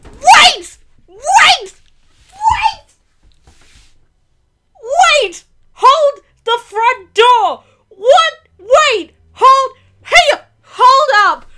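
high, loud bursts of disbelief; frantic shouting. Real, not acted. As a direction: shout for everything to stop. Frantic shock, calling for a halt.

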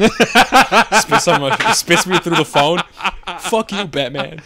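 high, loud, laughing all the way through. Real, not acted. Friend cracks up acting out cursing Batman, high and loud. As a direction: crack up while telling it.

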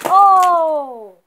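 sudden loud gasp; startled yelp at the spill; surprised shout. Real, not acted. Drops all the candies.

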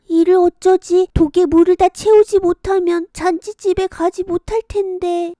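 put-on a girl close to tears of worry; worried girl. Kongjwi fearing she'll miss the feast, high worried voice.

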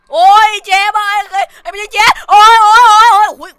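yelling in fright as the killer comes; panicked screaming. Real, not acted. Killer appears in horror game. (ร้องโวยวายด้วยความกลัว)

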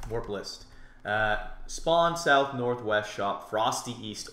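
usual speaking voice

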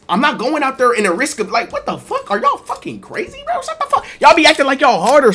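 squeaky exasperated rant; high ranting disbelief. Acted. Guy rants at friends who brag, high squeaky rant.

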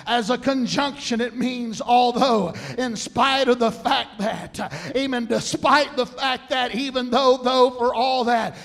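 loud, driving list of synonyms; preaching at full force. Acted. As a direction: punch out each phrase like a revival preacher. Preaches the definition on.

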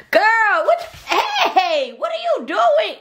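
very high, laughing protest; high laughing what are you doing; laughing surprise. Real, not acted. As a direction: yelp and laugh as hair is pulled. Baby pulling her hair.

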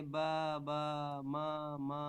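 "বা, বা, মা, মা" slow, each syllable held; babbling demonstration. Shown as a demonstration. Teacher answering with babble example, "বা, বা, মা, মা" drawn out slowly. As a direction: draw out "বা, বা, মা, মা" evenly on one note. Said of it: "babbling করে".

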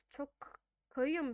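normal talking voice

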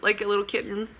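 ordinary talk

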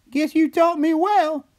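put-on very high, slow little-girl voice. A narrator's reading. Girl outdoes her brother, high slow teasing voice. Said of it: "she said as she winked".

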